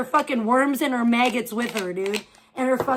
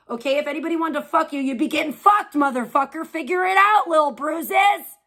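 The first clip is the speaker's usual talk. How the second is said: real furious shouting, louder and higher, at a troll; angry yelling; 3 semitones higher, 3 dB louder than usual. Real, not acted. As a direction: yell him out of the chat.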